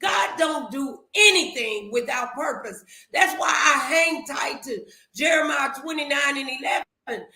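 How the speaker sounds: normal talking voice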